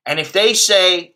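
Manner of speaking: ordinary talk, nothing marked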